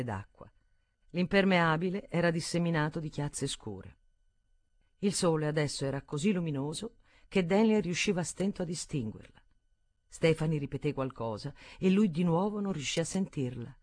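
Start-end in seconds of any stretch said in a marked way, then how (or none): none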